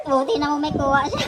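ordinary talk, nothing marked

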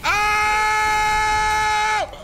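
very loud, high sustained scream; long scream. Real, not acted. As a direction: scream it out and hold it. Screams during the game.

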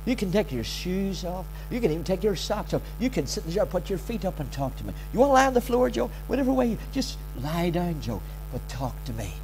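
soft, soothing fatherly voice; hushed, unhurried reassurance; tender invitation. Acted. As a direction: gently coax a son to relax. Voicing God's tender invitation to him.

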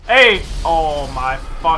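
loud, shocked outburst; real startled shout; startled yell. Real, not acted. Startled by sudden in-game mishap.

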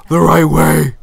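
loud insistent demand, punch right. Pretend. Voicing the Sim to the waiter, loudly demanding. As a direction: insist like a fussy customer.

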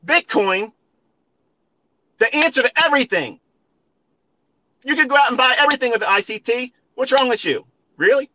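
high, mocking sarcasm; mock-cheerful high sarcasm; sarcastic mockery. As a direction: ham up a believer's hype with a sneer. Mocking crypto believers' hype.

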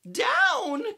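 high, loud sudden shout; shouted cry. Acted. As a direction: yell it out in alarm. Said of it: "cried the witch".

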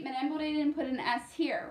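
normal talking voice

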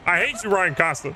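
high, loud complaint; exasperated outburst. Real, not acted. Gamer rails at own pitcher after a long homer, exasperated. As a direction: rail at the player, loud and high. Rages at player after home run.

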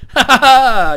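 loud, slow delighted laugh; happy laughter. Real, not acted. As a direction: let the joy burst out laughing. Laughing with joy at shout-out.

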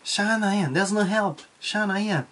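Osaka dialect. Shown as a demonstration. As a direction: toss it off in Osaka intonation. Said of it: "こんな感じです".

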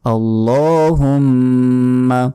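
আল্লাহুম্মা with the ল্লা held a full second, slowly; drawn-out Arabic. Shown as a demonstration. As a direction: stretch the lam in আল্লাহুম্মা, doubled মু. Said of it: "লতে এক second টান দিতে হবে".

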